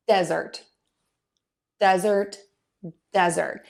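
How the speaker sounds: each "Desert" stressed DEZ-ert; stress on first syllable. Shown as a demonstration. Teacher models the word for learners, first syllable of "Desert" stressed. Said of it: "We're going to stress syllable one".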